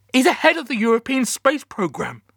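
loud, very high protest; shouting. A narrator's reading. Captain insists to the call handler about the colonel, shouting. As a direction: shout it in exasperation. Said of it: "I was shouting again".

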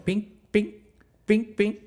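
high sung pings like a snapping wire; imitated pinging cable. Describing the cable under load, high imitated pings. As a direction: ring out the strained cable. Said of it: "you can just hear it going".

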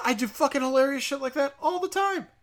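usual speaking voice

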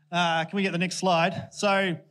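usual speaking voice

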